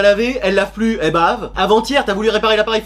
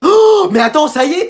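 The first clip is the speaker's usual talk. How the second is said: excited; 7 semitones higher, 5 dB louder than usual. Acted. Teacher thrilled flemme is gone.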